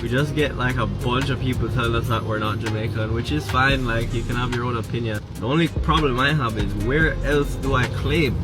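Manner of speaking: ordinary talk, nothing marked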